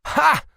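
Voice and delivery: single loud high bark of a laugh; loud mocking ha; sharp laugh. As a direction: burst out with one laugh. Laughs at his own joke.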